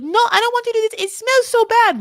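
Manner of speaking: very high, loud, fast mock French whine; hammed-up French-accented tantrum; upset French-accented wife. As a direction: squeal in disgust with a bad French accent. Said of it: "She's, like, so upset".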